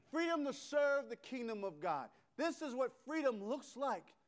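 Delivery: rising, rousing preacher cadence; building preaching. Acted. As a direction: lift the congregation with each repeat.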